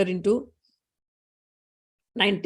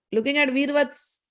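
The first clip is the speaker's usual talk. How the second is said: ordinary delivery, nothing marked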